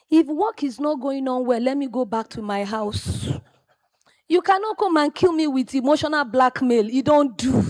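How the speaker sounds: loud, high angry retort; retold yelling back. Apprentice yelling back at her mentor, loud and high. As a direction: shout back at the boss. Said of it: "he screamed and I yelled".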